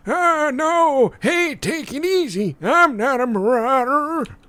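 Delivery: put-on high, loud, crotchety old-timer; old man voice. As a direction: yelp like a panicky old geezer. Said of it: "My old man voice is better".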